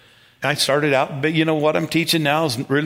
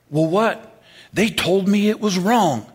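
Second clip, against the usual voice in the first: mock protest, higher and drawn out; indignant protest; 7 semitones higher, slower than usual. Woman answering pastor's advice to calm down, indignant. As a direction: imitate an upset churchgoer pleading her case.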